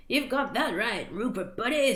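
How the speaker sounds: mildly slurred tipsy spirit; slurred speech. A narrator's reading. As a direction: lightly slur it like a drunken buddy. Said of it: "slurred the spirit".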